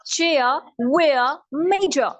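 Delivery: British word endings in open "ah"; British accent. Shown as a demonstration. Teacher repeats the list for contrast, British accent. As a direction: drop the final R, British.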